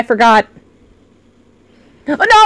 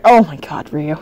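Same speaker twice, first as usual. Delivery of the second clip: usual speaking voice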